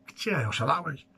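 ordinary delivery

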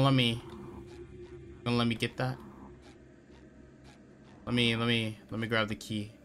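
usual speaking voice

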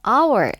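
"hour" with the H left silent. Shown as a demonstration. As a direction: start "hour" straight on the vowel, no H. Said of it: "H가 묵음이죠".

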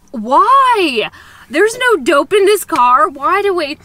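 mock outrage at the dog search; indignant protest. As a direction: insist there's nothing in the car. Indignant retort in the retold story.

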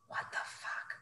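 breathy whispered curse. Real, not acted. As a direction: whisper it in disbelief. Shocked aside in narration.

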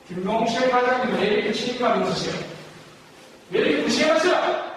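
rising, indignant-sounding blame; accusing reproach. Shown as a demonstration. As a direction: act out scolding a colleague, pitch climbing. Acts out blaming a manager.

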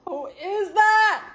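high, loud, stretched-out shriek; drawn-out high hype yell; hyped-up showing off. Pretend. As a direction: shout it in mock amazement, dragging the vowels. Shows off the new photo.

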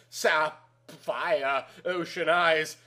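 ordinary delivery, nothing marked

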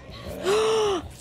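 high startled gasp; sharp gasp. Real, not acted. Streamer gasping as the killer appears, startled. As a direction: catch your breath in alarm. Sudden scare in game.

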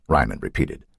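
plain reading voice

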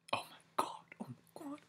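breathless whispered oh my god; hushed awe; awed whisper. Real, not acted. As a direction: whisper it in disbelief. Stunned by the video.

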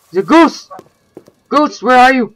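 high, loud rattled call; urgent calling. Real, not acted. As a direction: call out loudly for a lost bird. Calling for a goose while rattled.

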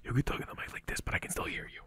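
hushed whisper into the mic; soft, voiceless demonstration whisper. Shown as a demonstration. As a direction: drop to a pure whisper, close on the microphone. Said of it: "I'm whispering inside of microphone".

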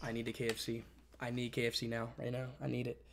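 low, very soft insistence; quiet pleading. Real, not acted. Hungry streamer needs KFC ordered now, quiet pleading. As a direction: press them urgently but keep it down. Begging someone to order food.

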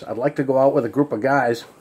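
ordinary delivery

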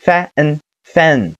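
"fan" stretched from its sounds into one syllable; blended syllable. Shown as a demonstration. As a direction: join the sounds of "fan" slowly. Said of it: "连起来读作".

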